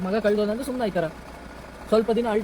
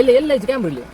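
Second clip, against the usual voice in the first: alarmed loud question; 2 semitones higher, 6 dB louder than usual. Acted. Kidnapper alarmed about a camera.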